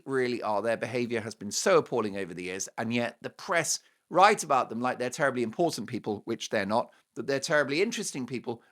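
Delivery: ordinary talk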